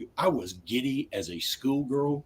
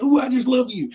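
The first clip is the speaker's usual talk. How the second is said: much higher, louder cooing; mock gushing wife; 13 semitones higher, 5 dB louder than usual. Wife hugging strangers goodbye, high gushing coo. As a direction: gush like a tipsy aunt. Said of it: "she's like".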